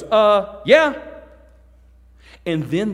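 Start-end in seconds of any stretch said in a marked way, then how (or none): 0.0-0.9 high, loud offhand answer; God shrugging a quick yes; mock casual reply from God. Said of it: "God goes".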